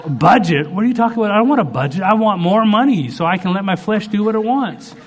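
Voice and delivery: high-pitched mock outrage; indignant protest. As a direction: push back like someone refusing good advice. Acted protest at God's budget advice.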